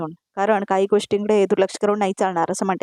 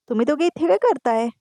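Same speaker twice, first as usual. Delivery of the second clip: higher-pitched, mildly startled question; surprised question; 7 semitones higher than usual. A narrator's reading. Priya asks the couple why they are here, mildly surprised. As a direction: ask it with a touch of surprise.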